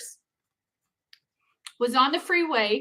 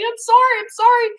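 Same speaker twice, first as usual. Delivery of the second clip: high, loud mock pleading; raised, repeated plea for mercy; acted panicked apology; 11 semitones higher, 6 dB louder than usual. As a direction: imitate yourself frantically apologizing. Acting her apology to screaming trucker.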